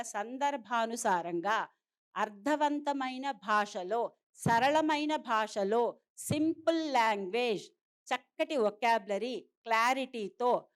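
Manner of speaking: very slow, each phrase spaced out; slow and clear. Shown as a demonstration. Teacher to parents, slow deliberate model of clear speech. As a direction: spell out each quality deliberately, slowly. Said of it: "ఇందాక madam చెప్పారుగా".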